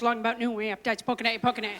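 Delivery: lifeless audition reading; rote, monotone line reading; flat read. Acted. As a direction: read it flat, low and unimpressed. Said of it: "just read it real flat".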